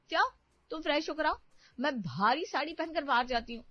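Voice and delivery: high-pitched, huffy scolding; angry young wife. Acted. Wife turns on husband for siding with his mother, angry. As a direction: snub him in a high, cross voice. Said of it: "गुस्सा क्यों होती हो".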